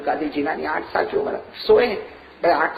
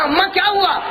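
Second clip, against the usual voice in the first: alarmed cry; 12 semitones higher than usual. Retold or imitated. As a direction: shout the question in alarm. Son cries out to mother.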